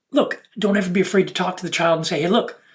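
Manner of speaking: usual speaking voice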